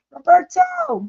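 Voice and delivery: high loud call of his name. Real, not acted. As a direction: call him from across the house. Calls husband across house.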